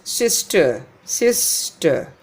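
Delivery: sister as SIS-tuh; first-syllable stress. Shown as a demonstration. Teacher demonstrating noun stress, sister stressed on SIS. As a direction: hit SIS in sister, let ter fall away. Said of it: "First syllable is stressed".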